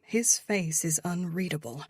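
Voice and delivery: plain reading voice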